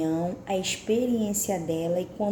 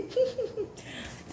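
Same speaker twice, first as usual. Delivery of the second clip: muffled laugh behind hand; 5 dB quieter than usual. Shown as a demonstration. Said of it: "eu ponho a mão na boca e".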